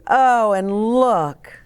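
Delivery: ordinary delivery, nothing marked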